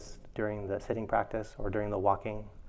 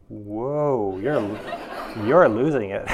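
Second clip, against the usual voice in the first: louder, half-laughing mock alarm; 10 dB louder, much slower than usual. Teacher retelling her mind freaking out, laughing. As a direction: blurt it while laughing at yourself.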